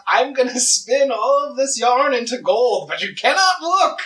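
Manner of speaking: high, giddy villain voice; fairy-tale imp voice. Acted. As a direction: squeal it like a gleeful Rumpelstiltskin.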